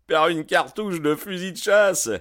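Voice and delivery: high, loud coarse shout. A narrator's reading. The neighbour shouts back to McMurdo, high and loud. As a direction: bark it out with a coarse laugh behind it. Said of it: "cria l'interpellé en éclatant d'un rire gras".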